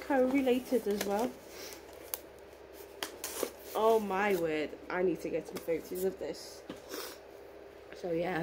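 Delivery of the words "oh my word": ordinary talk, nothing marked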